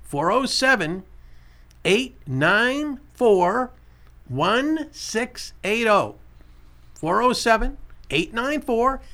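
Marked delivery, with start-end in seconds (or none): 0.0-6.1 very slow, digit-by-digit read-out; slow dictation, every digit separate; slow repeat. Shown as a demonstration. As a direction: stretch out each digit of the number for callers. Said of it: "I'll say it again".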